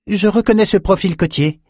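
high-voiced announcement; announcing young scholar. A narrator's reading. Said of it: "annonça Peretta".

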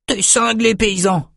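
high, incredulous retort; tossed-out retort. A narrator's reading. Jed calls the farm boy crazy, tossing it out high. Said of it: "lança Jed".

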